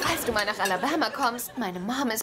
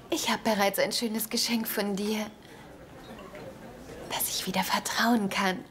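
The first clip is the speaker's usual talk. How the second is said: soft whisper; 4 semitones lower, 5 dB quieter than usual. Acted. Tender confession after gift question.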